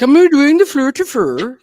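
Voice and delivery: a jokey stab at Norwegian; mock Norwegian accent. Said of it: "we're moving into the Norwegian".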